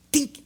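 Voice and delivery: tiny high "dink"; imitated bat tap. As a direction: make the little sound of bat nicking ball. Imitates bat tapping the ball.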